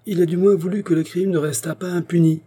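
harsh, high-pitched completion; rough interruption. A narrator's reading. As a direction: finish the other's sentence forcefully. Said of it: "acheva rudement l'inspecteur".

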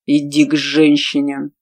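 slow clipped command; gritted order. A narrator's reading. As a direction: grind out the order. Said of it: "процедил".

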